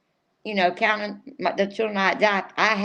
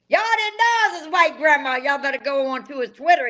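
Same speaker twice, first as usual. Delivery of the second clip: excited; 6 semitones higher than usual. Real, not acted. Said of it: "I'm getting amped up right now".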